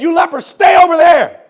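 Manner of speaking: loud, high order to keep away; acted townsfolk's shout; shouted warning. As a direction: shout it to ward them off.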